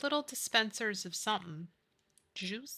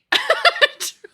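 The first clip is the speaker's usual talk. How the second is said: loud high laugh; a sudden squealing laugh; high burst of laughter; 11 dB louder than usual. Real, not acted. Laughing at her own cup mishap.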